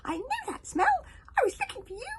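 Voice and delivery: very high, loud gleeful yell; hogfly finding Hiccup; excited shout. Acted. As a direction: shout in delight. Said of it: "shouted that hogfly".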